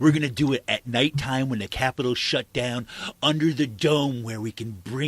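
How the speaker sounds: a hint of a bullying politician, first words loud; lightly voiced menacing politician; mock Saccone threat. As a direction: sneer it out as a cartoon bully lawmaker.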